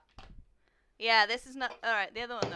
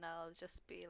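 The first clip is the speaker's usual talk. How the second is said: whisper; 4 semitones lower, 14 dB quieter than usual. Real, not acted. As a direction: hush it under your breath. Sneaky aside before quote.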